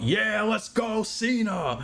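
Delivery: high, drawn-out mock yell; Vince. Imagining the boss cheering for Cena, high mock yelling. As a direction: whoop it like a giddy fan. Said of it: "Could you imagine Vince yelling out".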